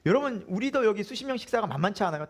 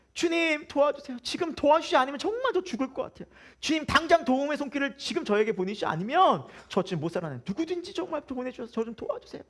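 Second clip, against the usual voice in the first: high, pleading cry to the Lord; desperate prayer; 8 semitones higher than usual. Retold or imitated. A believer in crisis begging the Lord for help, high and pleading. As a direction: beg God for help, voice lifted high. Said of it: "이런 기도".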